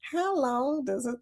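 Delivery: Kentucky twang. Retold or imitated. Imitating the casino lady for the viewers, high Kentucky twang. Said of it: "her Kentucky twang".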